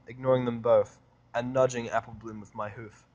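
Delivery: ordinary delivery, nothing marked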